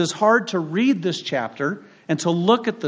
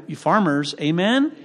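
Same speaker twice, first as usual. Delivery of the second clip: usual speaking voice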